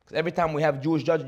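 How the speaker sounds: ordinary talk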